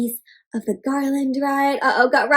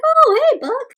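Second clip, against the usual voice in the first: a light, much higher cheery Santa voice; Santa greeting; 11 semitones higher than usual. Retold or imitated. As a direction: greet Buck brightly. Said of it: "Santa's like".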